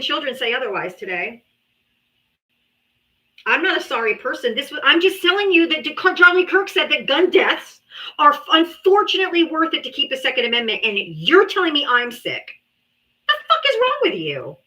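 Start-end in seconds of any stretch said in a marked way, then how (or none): 4.5-14.6 angry. Real, not acted. Angry rant at a Trump voter.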